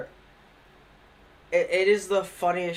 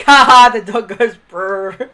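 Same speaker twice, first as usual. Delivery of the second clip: loud gleeful ha ha brrr; gleeful inner laugh; 3 semitones higher, 12 dB louder than usual. Retold or imitated. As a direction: revel in the chaos. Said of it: "Me in my head being like".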